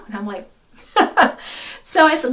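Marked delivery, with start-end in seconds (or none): 0.9-1.8 brief breathy laugh; short burst of laughter. Real, not acted. Storyteller reacting to her own tale, amused laugh.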